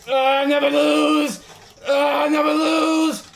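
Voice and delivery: high, loud, long-held wail; screamed boast. Real, not acted. Player refusing to lose, screamed boast. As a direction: scream it out, stretching every word.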